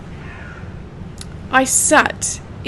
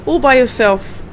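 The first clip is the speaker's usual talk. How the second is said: normal talking voice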